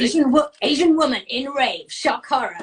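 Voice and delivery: mock-scandalised headline announcement; lightly hammed-up tabloid shock; mock newspaper headline. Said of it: "newspapers have kind of made quite a big deal".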